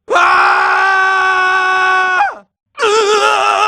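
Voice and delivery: high sharp cry of pain; pained shout. Acted. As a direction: yell as the blow lands. Hero hit in a fight.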